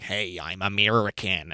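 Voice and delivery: low, soft, hammed-up American accent; bad American accent. Mocking a British actor's attempt at American, bad American accent. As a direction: put on a badly faked American voice. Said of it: "he's doing such a bad American".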